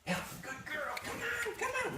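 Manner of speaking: very high, soft coaxing; high coaxing to Ellie; coaxing call. Real, not acted. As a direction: lure the dog with a squeaky voice. Coaxing reluctant dog.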